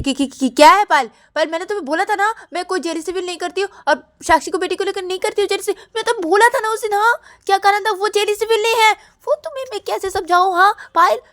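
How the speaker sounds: high, loud, rushed protest; acted exasperated outburst; frantic denial. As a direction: stammer in, then argue fast and high. Ananya protests to Payal, rattled.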